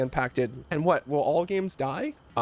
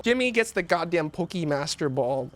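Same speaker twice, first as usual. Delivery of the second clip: usual speaking voice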